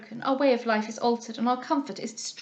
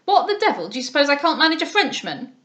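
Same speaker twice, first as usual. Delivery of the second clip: loud, higher, scornful outburst; angry blustering; 4 semitones higher, 7 dB louder than usual. A narrator's reading. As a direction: bellow it, full of contempt. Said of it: "cried he angrily".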